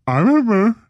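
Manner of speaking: high, goofy dog voice; dog's voice. Retold or imitated. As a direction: play the dog, high and silly. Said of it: "This is what he wrote".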